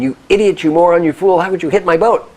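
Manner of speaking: loud, high enraged tirade; acted furious yelling; angry screaming. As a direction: scream insults at the boat that hit you. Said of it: "starts screaming".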